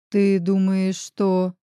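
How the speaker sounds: plain reading voice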